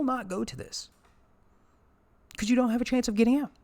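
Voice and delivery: furious esports rant; angry rant. Real, not acted. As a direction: rant about the unfair seeding, voice climbing. Ranting about tournament format.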